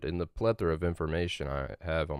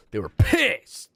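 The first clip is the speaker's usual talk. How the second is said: emphatic and louder; 7 semitones higher, 7 dB louder, slower than usual. Real, not acted. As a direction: say it again with more punch. Repeats it louder.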